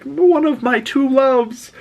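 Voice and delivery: slow, very high-pitched wail; mock anguished cry. Rogue seeing Magneto killed, mock angry wail. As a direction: imitate her crying out, drawn out high. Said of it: "pissed, and she's crying".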